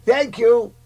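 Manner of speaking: mock sweet thanks; high thank you. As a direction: sing out thanks. Old gent voice shifts high.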